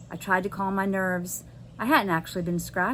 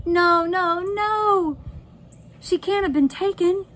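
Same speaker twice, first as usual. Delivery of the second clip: high, louder panicked cry; 10 semitones higher, 4 dB louder, slower than usual. Acted. Josie panics at empty room.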